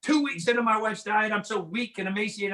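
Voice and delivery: ordinary talk, nothing marked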